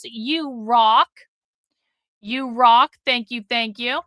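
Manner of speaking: normal talking voice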